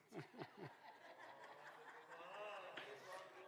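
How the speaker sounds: real breathy laugh at his own joke. Real, not acted. Preacher laughing at his own quip to the church. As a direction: laugh it off, amused.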